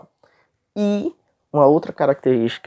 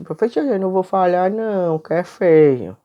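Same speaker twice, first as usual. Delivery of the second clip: lightly voiced schoolboy, higher pitch; menino imitado; 6 semitones higher than usual. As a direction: mimic a pupil politely refusing. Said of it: "o menino disse". (imitando de leve um menino, mais agudo)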